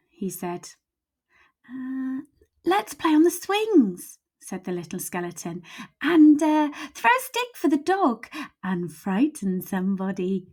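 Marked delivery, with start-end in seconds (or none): 1.7-4.2 put-on high, slow childlike skeleton voice; a small skeleton sweetly suggesting play; little skeleton. Little skeleton asks big skeleton to play, high and slow. 6.0-10.5 little skeleton. Acted.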